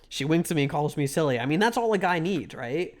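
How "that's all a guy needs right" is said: ordinary talk, nothing marked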